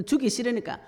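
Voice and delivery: usual speaking voice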